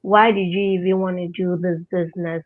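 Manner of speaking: ordinary talk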